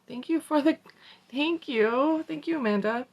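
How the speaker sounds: squeaky high thank-you; high sing-song thanks. Pretend. Playful mock thanks to herself.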